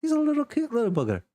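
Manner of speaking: very high, fast doting gush; cooing. Acted. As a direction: gush over a cute pet. Rosie cooing over pet.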